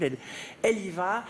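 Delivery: ordinary talk, nothing marked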